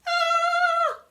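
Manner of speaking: a small bird screaming in fright; tiny creature's scream. A narrator's reading. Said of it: "screamed a tiny feathered thing".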